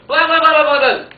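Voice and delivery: high, loud rapid-fire door knocks; hammering sound effect; loud knocking imitation. A narrator's reading. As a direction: bang on the door with your voice.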